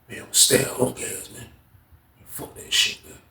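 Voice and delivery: unvoiced hushed reply; whisper. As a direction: brush it off under your breath. Hushed reply in secret talk.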